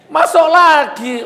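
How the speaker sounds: loud, high, slow exasperation; exasperated outcry. Real, not acted. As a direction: drag it out in disbelief. Exasperated retelling.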